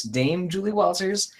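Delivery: ordinary talk, nothing marked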